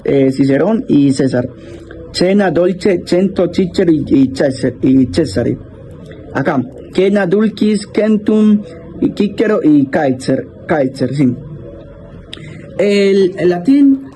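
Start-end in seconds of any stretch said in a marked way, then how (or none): 2.1-5.6 Cena, dolce, cento read with Italian ch sounds. Shown as a demonstration. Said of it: "después en italiano". 6.3-11.3 cena, dulcis, centum read with a hard k; Latin reading. Shown as a demonstration. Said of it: "después en latín".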